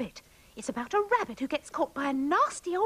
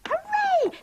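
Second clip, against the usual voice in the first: much higher, louder hammed-up hooray; mock cheer; 5 dB louder than usual. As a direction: fake a joyful whoop. Fake cheer at no skating.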